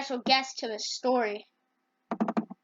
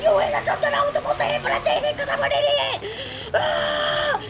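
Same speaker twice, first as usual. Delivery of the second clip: very high, fast furious screech; angry shout; 13 semitones higher than usual. Acted. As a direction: scream it like a toon losing it. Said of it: "Ryan Anderson is so angry".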